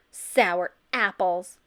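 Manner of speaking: slow, loud snarl; slow angry snarl at him. A narrator's reading. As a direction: snarl the words. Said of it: "Beatrice snarled".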